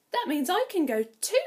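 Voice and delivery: put-on hopeful girl asking to come; hopeful Cinderella. Cinderella eagerly asks to go to the ball. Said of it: "Cinderella said".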